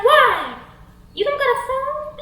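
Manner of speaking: high, insistent swaggerer; creepy Devon. Acted. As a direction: press her like a pushy flirt. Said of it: "And he's like".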